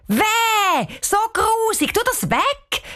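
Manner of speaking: high, grossed-out call; disgusted cry. Acted. Cheyenne recoiling from the dog poop, disgusted high cry. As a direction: squeal it like it stinks. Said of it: "Ruft Cheyenne".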